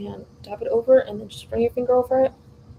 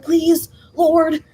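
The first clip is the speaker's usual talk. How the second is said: intense prayer; 6 semitones higher, 6 dB louder than usual. Retold or imitated. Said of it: "intensely praying".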